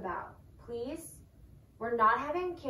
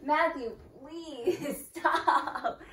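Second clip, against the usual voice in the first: slow pleading through laughter; laughing plea; 3 semitones higher, 3 dB louder, much slower than usual. Real, not acted. Girlfriend begging her boyfriend to stop, laughing. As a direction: beg him to stop while giggling.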